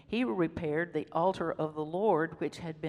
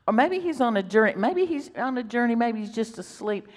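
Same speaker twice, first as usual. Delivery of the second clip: higher, louder sarcastic jab; mocking taunt; 5 semitones higher, 6 dB louder than usual. Elijah prods Baal's prophets with a higher, louder sarcastic jab. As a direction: needle them with fake concern. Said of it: "he's prodding them".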